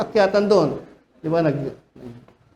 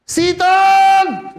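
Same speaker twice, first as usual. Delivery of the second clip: high, loud rebuke yell; pastor shouting; 12 semitones higher, 5 dB louder than usual. Retold or imitated. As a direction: banish Satan with a roar. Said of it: "Ang lakas ng boses niya". (sigaw ng pastor, mataas at malakas na pagpapalayas kay Satanas)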